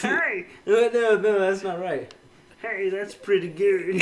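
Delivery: Cleveland hey. Retold or imitated. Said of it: "Do the hey".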